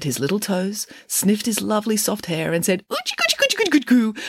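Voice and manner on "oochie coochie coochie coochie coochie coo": very high cooing; baby talk. A narrator's reading. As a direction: coo at a newborn in a squeaky-high voice. Shepherds coo at newborn.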